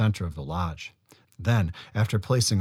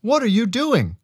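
high, loud call to his sister, out of breath; breathless call; 13 semitones higher, 5 dB louder than usual. A narrator's reading. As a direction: run in calling out, winded. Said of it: "called Awasos as he and Casco, both completely out of breath".

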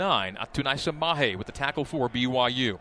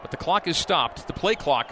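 usual speaking voice

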